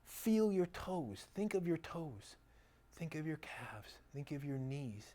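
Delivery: slow, soft meditation guide voice; guided meditation. Shown as a demonstration. Demoing a Yoga Nidra video to audience, slow soft guiding voice. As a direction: lead the body scan gently and slowly. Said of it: "they just walk you through".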